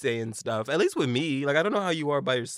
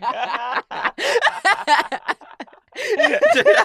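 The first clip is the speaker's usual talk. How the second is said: loud laughter; 11 dB louder than usual. Real, not acted. Cracking up at own words.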